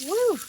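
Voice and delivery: high, loud "woo" whoop; sharp rising-and-falling whoop. Real, not acted. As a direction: let out a sudden high whoop at the smoke. Opening the smoking cooker.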